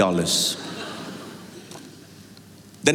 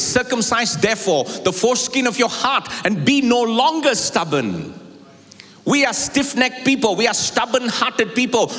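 much higher, ringing preacher's call to the flock; full-force preaching; 9 semitones higher than usual. Acted. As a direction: lift it up and drive it home to the church.

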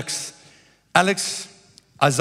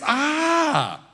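high, long "aaah", falling at the end; acted "ah" of realization; 9 semitones higher than usual. Preacher acting out the view after another step, dawning "ah". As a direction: let a big revelatory "ah" swell out.

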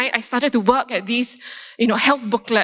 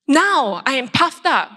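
normal talking voice

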